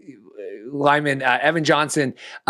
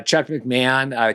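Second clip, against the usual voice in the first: ordinary talk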